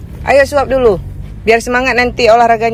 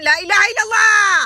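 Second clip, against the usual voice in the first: exasperated cry; 10 semitones higher than usual. Real, not acted. Mother exasperated, son won't drink.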